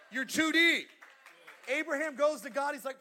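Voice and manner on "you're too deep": emphatic repeat. Shown as a demonstration. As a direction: repeat it louder and higher.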